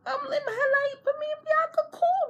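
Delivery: very high, cracking squeal; squeaky disbelief. Retold or imitated. Insisting she and Bianca are fine, in a high squeal.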